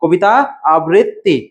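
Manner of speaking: আবৃত্তি split into a-brit-ti, pause before it; segmented আবৃত্তি with held double t; broken-up pronunciation. Shown as a demonstration. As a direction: pronounce আবৃত্তি piece by piece. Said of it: "খণ্ড খণ্ড ভাবে উচ্চারণ করবে".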